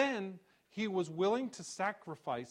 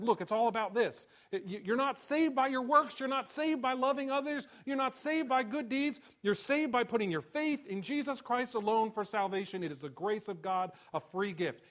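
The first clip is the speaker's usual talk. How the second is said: higher and louder, insistent preaching; preaching Paul's words; 8 semitones higher, 7 dB louder than usual. Acted. Pastor voicing Paul to the congregation, preaching at full force. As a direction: hammer home not saved by works.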